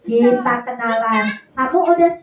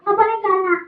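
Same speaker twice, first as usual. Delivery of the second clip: higher-pitched puppet voice; puppet character; 8 semitones higher, faster than usual. Acted. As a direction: parrot it back as the puppet. Puppet parrots presenter's admonition.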